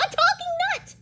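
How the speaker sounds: very high, loud amazed rabbit; shrieking. Acted. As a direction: shriek in astonishment. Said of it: "shrieked Littlest Rabbit".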